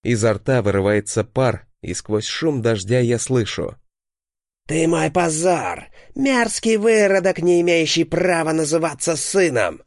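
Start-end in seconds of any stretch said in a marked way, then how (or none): 4.7-9.8 inhuman furious voice. A narrator's reading. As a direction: shout it like an eldritch horror raging at its son. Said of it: "кричит яростный, нечеловеческий голос".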